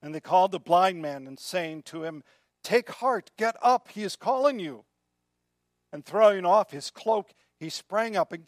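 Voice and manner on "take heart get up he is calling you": loud, higher rousing call; rally someone to get up; punchy, raised-voice encouragement; urging on. Retold or imitated. Crowd urging on the blind man.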